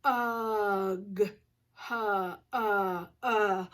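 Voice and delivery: "Ugh, huh, uh, uh" as hug in stretched short-vowel pulses; slow sounding-out. Shown as a demonstration. Teacher checking hug for its vowel, slowly sounded out. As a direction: draw hug out on its uh. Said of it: "let's say 'em slow".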